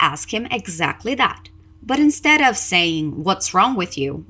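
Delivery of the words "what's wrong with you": usual speaking voice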